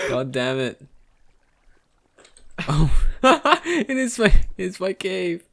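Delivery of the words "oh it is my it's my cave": high, slow, laughing disbelief; shocked delight at the discovery; surprised. Real, not acted. Finds friend in own cave.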